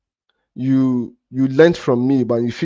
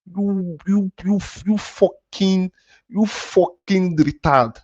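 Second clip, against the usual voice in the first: slow angry insult; 6 semitones higher, much slower than usual. Real, not acted. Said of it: "Yes, I'm angry".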